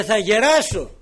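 loud, high, firm "Θα γεράσω"; loud affirmation. Acted. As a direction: hammer home "Θα γεράσω" loudly. Peter's words, repeated loud.

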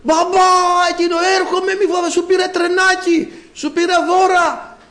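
calling out. Retold or imitated. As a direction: shout up to the child on the fourth floor. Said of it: "και εγώ να φωνάζω".

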